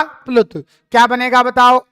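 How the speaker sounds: ordinary talk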